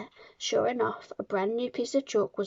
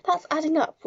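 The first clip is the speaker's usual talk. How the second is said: higher, louder schoolboy protest; crying out; 7 semitones higher, 5 dB louder than usual. A narrator's reading. Pupil objects to the headmistress's sum, crying out. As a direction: blurt it out in protest. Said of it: "Wilfred cried".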